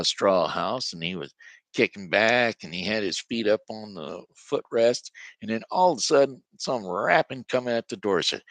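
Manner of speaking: ordinary delivery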